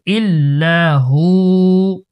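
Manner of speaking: ইল্লাহু slowly, ending on a long oo; pause-form ইল্লাহু with the final vowel held; one-alif stretch. Shown as a demonstration. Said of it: "সাকিন দিলে এক আলিফ টান হবে". (ইল্লাহু-র শেষে এক আলিফ টান)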